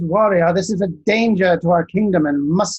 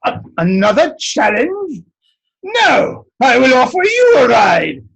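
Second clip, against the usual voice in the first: much higher and louder, bursting out; booming giant; 6 semitones higher, 5 dB louder than usual. Acted. Giant tops the wise one's offer, loud and high. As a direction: blurt it out big and proud.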